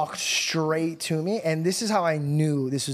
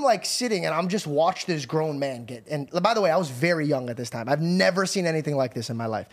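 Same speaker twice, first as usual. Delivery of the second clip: ordinary delivery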